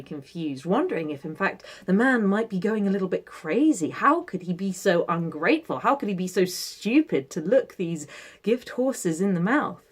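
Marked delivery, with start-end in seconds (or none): none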